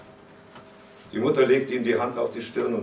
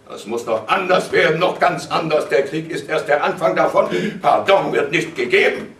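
raised, loud, forceful warning; emphatic father laying down the law; menacing proclamation; 6 semitones higher, 9 dB louder than usual. A narrator's reading. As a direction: threaten worse to come. Father's threat closes the quarrel.

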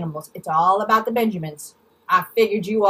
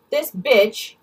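ordinary talk, nothing marked

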